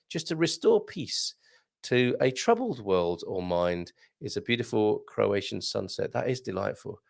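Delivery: hushed, settled appreciation; soothing. Real, not acted. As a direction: lull them gently into calm. Soothing listeners with a sunset.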